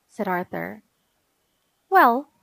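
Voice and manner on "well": higher, louder huffy opener; a touch of sniffy little-sister disdain; haughty sniff. A narrator's reading. Sister unimpressed by brother's reading, sniffy. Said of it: "sniffed D W".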